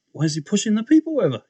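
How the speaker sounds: high, fast, baffled question. Retold or imitated. Newcomer watching people fall at church, baffled. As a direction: rattle it off, confused by the scene. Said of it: "So I'm thinking".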